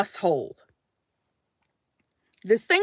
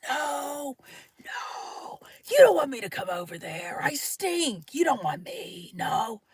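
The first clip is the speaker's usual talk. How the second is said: whispery, much softer plea; hushed refusal; 3 semitones higher, 7 dB quieter, slower than usual. Retold or imitated. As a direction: refuse under your breath so nobody wakes. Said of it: "I was like this".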